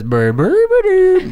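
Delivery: burbling creature call rising into a loud squeal; babble, then swoop way up high and hold it; Grimace voice. Acted. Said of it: "And he says".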